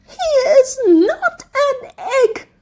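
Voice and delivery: a princess defending her love through tears; sobbing. A narrator's reading. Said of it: "sobbed the princess".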